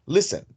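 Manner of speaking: listen with a high, punched LIS; listen as LIS-ten, falling away after LIS; stress on first syllable. Shown as a demonstration. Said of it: "el acento va en la primera sílaba".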